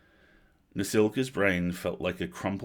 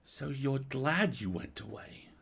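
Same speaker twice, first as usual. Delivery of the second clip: hurt, glaring; 7 semitones higher, 3 dB quieter, slower than usual. A narrator's reading. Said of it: "glared up at her brother".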